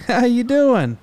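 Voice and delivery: high, loud happy hello; excited greeting. Real, not acted. Greets caller on the phone.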